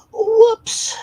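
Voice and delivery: Whoops squealed very high and loud; squeaky whoops. Real, not acted. Streamer slipping up, squealing Whoops high and loud.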